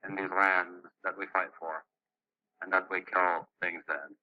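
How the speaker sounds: ordinary delivery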